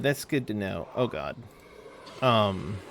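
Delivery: normal talking voice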